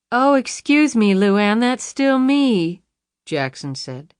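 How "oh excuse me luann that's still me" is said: high, slow, gloating mock apology. A narrator's reading. Jackson reveals his mimicry to Luann, high and gloating. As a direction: savor the cruel trick. Jackson mocking after trick.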